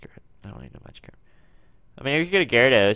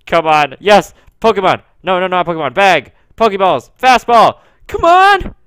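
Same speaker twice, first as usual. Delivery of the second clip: loud, higher panicked calls; real gamer panic, louder; frantic shouting; 7 semitones higher, 15 dB louder than usual. Real, not acted. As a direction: scramble through the menu yelling. Frantic catching attempt.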